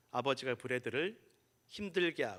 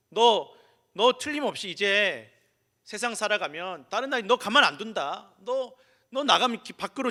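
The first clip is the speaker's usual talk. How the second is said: louder, higher, insistent fatherly warning; stern father's warning; 4 semitones higher, 5 dB louder than usual. Retold or imitated. Father warning his small son about the world outside, raised insistent voice. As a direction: press the warning home, raised voice.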